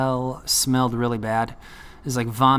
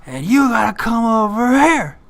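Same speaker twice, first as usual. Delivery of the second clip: high, loud, drawn-out holler; imitating a tiny guy's long yell; imitated yelling; 10 semitones higher, 7 dB louder, slower than usual. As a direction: holler across the jail, stretching the words. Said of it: "he yells at him".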